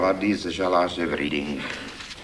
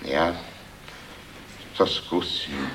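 thoughtful; 6 dB quieter, slower than usual. A narrator's reading. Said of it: "pravil hlas zamyšleně".

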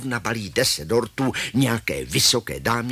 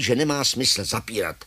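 ordinary delivery, nothing marked

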